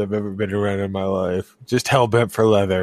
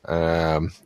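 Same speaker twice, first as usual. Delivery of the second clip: ordinary delivery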